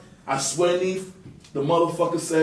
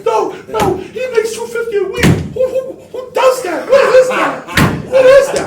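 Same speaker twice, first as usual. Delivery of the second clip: much higher, incredulous mock voice; mocking disbelief; 6 dB louder than usual. Mimicking the mogul who can't believe his salary, high squeal. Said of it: "Why you?".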